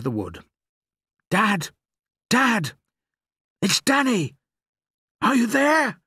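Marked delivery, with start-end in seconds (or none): 1.3-2.7 high, loud shout for Dad; calling out. A narrator's reading. Boy searching the dark wood for his father, calling out. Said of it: "walking and calling out". 3.6-6.0 high, loud searching shout; calling out. A narrator's reading. Boy hunting for his father in the wood, calling out. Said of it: "walking and calling out".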